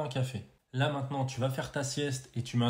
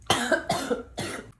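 coughing fit; 10 semitones higher than usual. Acted. Choking on water.

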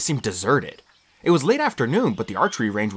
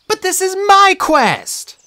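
put-on high, loud, slow girl's protest; a leader refusing to step aside; high, loud stubborn protest; insistent Annabeth; 8 dB louder, much slower than usual. A narrator's reading. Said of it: "Annabeth said".

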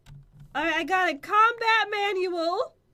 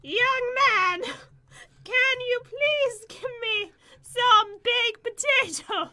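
higher, slow, drawn-out granny request; grandma voice; 4 semitones higher, slower than usual. Acted. Player asks friend for baked potatoes on request, in a grandma voice. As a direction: put on a demanding granny voice, word by word. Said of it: "say it in the voice, and I'll do it".

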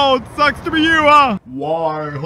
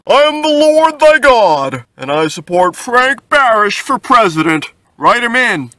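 louder, higher, commanding; thundering God voice; booming divine proclamation; 3 semitones higher, 8 dB louder than usual. Acted. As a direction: proclaim from the heavens. God booms endorsement.

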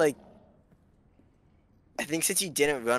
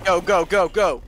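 loud urgent calls; shouting; 6 semitones higher, 11 dB louder than usual. Real, not acted. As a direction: yell them forward. Urgent combat calling out.

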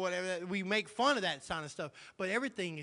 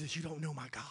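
quiet; 5 dB quieter than usual. Real, not acted. Repeated line, soft then loud.

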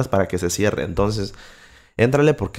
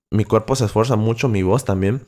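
ordinary delivery

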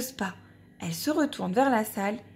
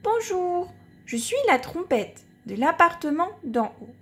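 little trumpet voice; 6 semitones higher than usual. Acted. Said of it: "La trompette s'en va sonner".

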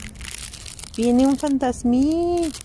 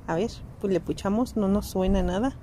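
ordinary delivery

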